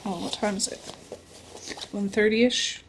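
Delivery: ordinary talk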